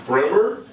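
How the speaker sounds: Bremer said as a question, rising high; unsure pronunciation. Shown as a demonstration. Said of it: "is that how you say his name?".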